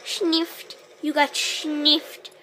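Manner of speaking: ordinary talk, nothing marked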